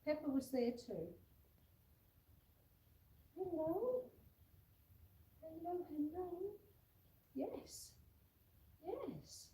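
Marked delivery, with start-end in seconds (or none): 3.4-9.5 higher, sing-song cooing hellos that fade to soft yeses; tender high puppy talk, the yeses gone soft. Real, not acted.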